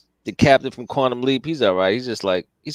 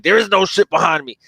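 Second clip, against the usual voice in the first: higher-pitched retold Klingon protest; mock indignant denial; 5 semitones higher, 3 dB louder than usual. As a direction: play the offended Klingon, lightly hammed-up.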